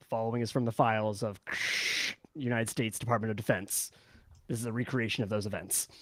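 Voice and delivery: low, grave government-file narration; documentary narrator. Acted. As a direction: read it like a classified film intro. Reads a documentary film intro.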